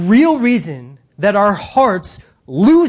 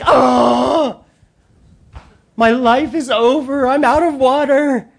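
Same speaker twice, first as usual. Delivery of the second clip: mock dramatic despair; 4 semitones higher, slower than usual. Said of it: "she's like".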